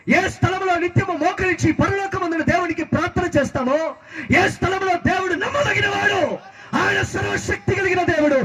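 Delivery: high, driving sermon cry; full-force preaching. Real, not acted. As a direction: build fervently through each phrase, pitched high. (ఆవేశంగా, ఎత్తైన స్వరంతో ప్రసంగం)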